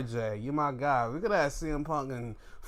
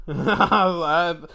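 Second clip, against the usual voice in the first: laughing; 3 semitones higher, 11 dB louder, slower than usual. Real, not acted. Laughing at Seth's attire.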